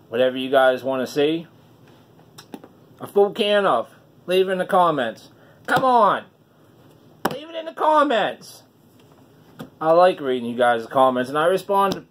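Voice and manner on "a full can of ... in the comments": higher, pleading call to comment; rally the viewers, voice climbing; excited urging. Real, not acted.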